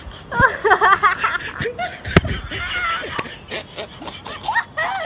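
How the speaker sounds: high shrieking laugh. Real, not acted. Couple's fake fight breaks. (ज़ोर से ठहाका)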